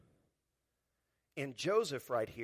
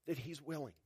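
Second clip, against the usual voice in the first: ordinary delivery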